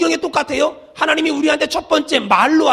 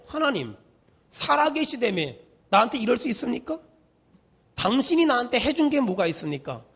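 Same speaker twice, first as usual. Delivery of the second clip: quiet, mock-resentful questioning; aggrieved complaint; 8 dB quieter than usual. Resentful believer asking what God has done, quiet complaint. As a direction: grumble softly at God, in broken phrases.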